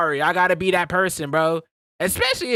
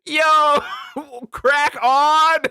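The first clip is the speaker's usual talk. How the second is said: excited mock slang; 7 semitones higher, 4 dB louder, much slower than usual. Repeating phrase delightedly.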